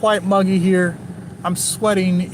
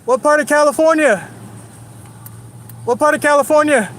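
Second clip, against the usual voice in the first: much higher, louder pushy question; mock challenge; 8 semitones higher, 4 dB louder than usual. Teasing a California driver, loud mock challenge. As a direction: call it out like you're sizing him up. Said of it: "Just G check him".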